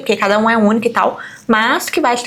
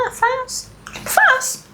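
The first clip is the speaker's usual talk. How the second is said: much higher, breezy brush-off; mock dismissive; 12 semitones higher than usual. Friends telling the mother it's fine, mock breezy. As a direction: toss it off like it's nothing, voice up high. Others brushing off child's tantrum.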